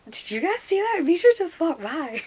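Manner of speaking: high, loud, giddy exclamation; starstruck fangirl squeal. Retold or imitated. Fans in line after Misha walked by, starstruck. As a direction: gush at a celebrity sighting. Fans reacting to star passing.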